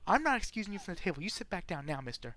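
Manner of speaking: put-on high and fast, scolding a naughty boy; stern wife voice. Wife ordering the guest back to the table, high scolding voice. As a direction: order him back like a strict mother. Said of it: "She's like".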